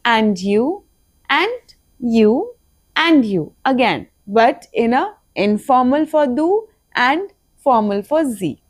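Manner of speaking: normal talking voice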